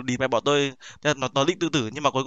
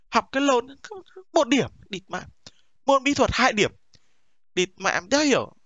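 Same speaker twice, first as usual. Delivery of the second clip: mock scolding; 7 semitones higher than usual. Scolding friend's bad grades.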